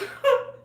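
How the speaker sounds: high, breaking laugh; real laugh. Real, not acted. Laughing as she starts the hospital story.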